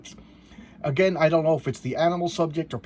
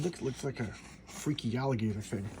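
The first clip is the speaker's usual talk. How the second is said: normal talking voice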